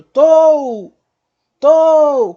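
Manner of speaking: "tou" bem forte e agudo, escorregando pro U fraco; desça de "tou" como numa ladeira; ladeira descendo. Shown as a demonstration. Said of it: "uma ladeira descendo". ("tou" longo, O forte e U sumindo)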